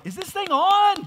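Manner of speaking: high, loud mock mic test; meme voice. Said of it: "what was that meme?".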